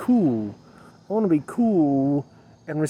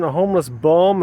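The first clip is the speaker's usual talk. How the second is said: normal talking voice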